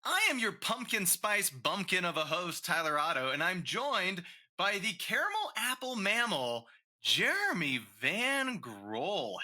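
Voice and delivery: big, high game-show intro; hammed-up host intro. Show host intro over music.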